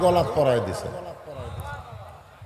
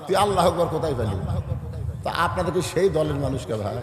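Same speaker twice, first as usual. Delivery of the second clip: usual speaking voice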